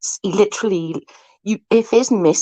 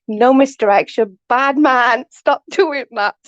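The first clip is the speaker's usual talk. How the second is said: high, playful telling-off; mock scolding; 6 semitones higher, 3 dB louder than usual. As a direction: scold him like a naughty pet, not meaning it.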